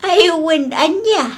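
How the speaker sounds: slow words dissolving into laughter; amused chuckle while answering; laughing. Real, not acted. Laughs at outfit of the day.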